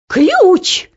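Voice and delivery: high, loud cry of alarm; wailing yell. A narrator's reading. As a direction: yell it out sharply. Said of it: "завопила Анидак".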